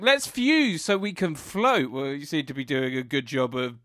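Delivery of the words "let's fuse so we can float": chirpy Connie. Retold or imitated. Connie quoted cheerfully.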